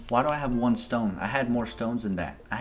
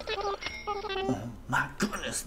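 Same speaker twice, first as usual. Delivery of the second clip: tiny, very high squeal; drawn-out, high gasp of delight; giddy disbelief; 6 dB quieter, much slower than usual. Real, not acted. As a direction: squeak it out slowly and softly. Overwhelmed by the money total.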